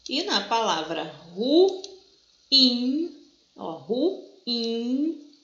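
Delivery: ruim stretched slowly into two syllables, ru-im; careful ruim. Shown as a demonstration. Teacher modelling the correct ruim for students, slow and careful. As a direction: spell out ruim as ru-im, slow and clear. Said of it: "A gente tem um hiato, a pronúncia certa da palavra".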